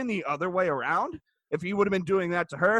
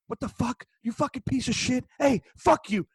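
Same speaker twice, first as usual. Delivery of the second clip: higher-pitched mock scolding; imitated cursing wife; 4 semitones higher than usual. Wife cursing at her husband, imitated angry scolding. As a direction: imitate her berating him, raised pitch without full volume.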